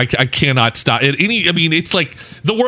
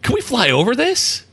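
naive question; 8 semitones higher than usual. Retold or imitated. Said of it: "then I'm going".

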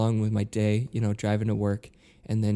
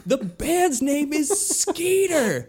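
high, slow, spelled-out yell; yelled correction; much slower than usual. Retold or imitated. Woman in the crowd yelling the band's real name, slow and high. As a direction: drag it out so he finally hears. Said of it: "she shells again".

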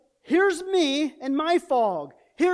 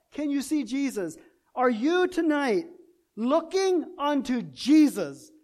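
ordinary talk, nothing marked

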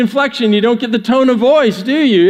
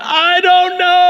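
high, drawn-out shout; big mock-frustrated shout; all-caps yell; 11 semitones higher, slower than usual. Shown as a demonstration. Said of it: "all ca".

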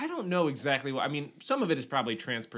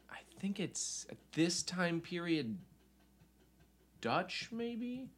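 whispering; 5 semitones higher, 6 dB quieter, slower than usual. Real, not acted. Searching notes, drops voice.

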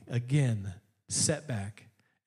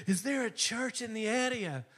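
imitated Irish lilt, lightly raised; Irish accent; 8 semitones higher than usual. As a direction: imitate an Irishman asking a question. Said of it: "He's from Ireland".

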